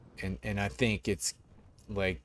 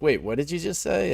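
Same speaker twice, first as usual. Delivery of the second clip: higher, a little louder, stunned question; shocked designer; 8 semitones higher, 4 dB louder than usual. Retold or imitated. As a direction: catch him out, startled and high. Designer's reaction retold again.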